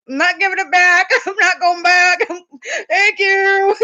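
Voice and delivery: high, squealing delight; giddy, high-pitched and laughing; retold glee. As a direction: play up a gleeful refusal through laughter. Retold delighted refusal to return toy.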